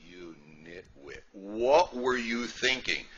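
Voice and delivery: slow, drawn-out mock rebuke; a hint of a wife chewing out her husband; mock scolding. Wife chiding husband for eating rolls, slow mock rebuke.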